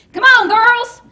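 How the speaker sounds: loud and high, scolding at full volume; exasperated yelling. Acted. Mother scolding Ronnie Frank for not watching Joey, loud and high. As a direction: yell at the husband who lost the kid.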